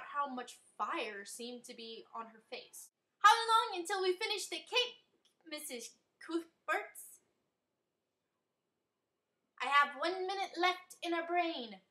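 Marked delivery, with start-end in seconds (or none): 3.2-7.2 high silly voice. A narrator's reading. Goofy friend asks mom. 9.6-11.8 high silly voice. A narrator's reading. Mom answers nonsensically.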